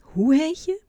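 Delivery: high, loud call to be heard; an old man who can't hear asking loudly; loud question. A narrator's reading. The hard-of-hearing old man asks the boy's name, high and loud.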